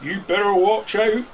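a fisherman shooing off a fish; gruff fisherman warning. A narrator's reading. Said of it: "said the fisherman".

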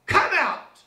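a mock yell from the besieged house. A narrator's reading. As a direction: bellow it out at them. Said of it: "Donald yelled".

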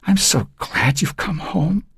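put-on quavering, hushed old mother; palsied voice. A narrator's reading. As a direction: let the voice shake like frail hands. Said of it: "her voice as palsied as her hands".

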